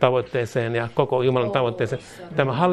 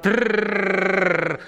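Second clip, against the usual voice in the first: sustained tongue-rolled "trrr"; long rolled trill; 6 semitones higher than usual. Real, not acted. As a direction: roll the R out long like a motor. Rolled noise amid praying in tongues.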